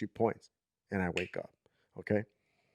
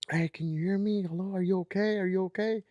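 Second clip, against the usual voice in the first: high, sustained questioning calls; calling out; 10 semitones higher than usual. Retold or imitated. Dreamer calling to the crying girl while approaching, calling out. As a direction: call out to check on someone.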